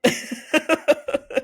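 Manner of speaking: high breathy burst of laughter. Real, not acted. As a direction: squeal of laughter without voice. Laughing at the neck line.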